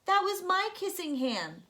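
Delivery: put-on high, loud, heartbroken child's cry; a little raccoon's sorrow spilling over; saddest voice. A narrator's reading. Little raccoon to his mother, high and heartbroken. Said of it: "in the saddest voice his mother had ever heard".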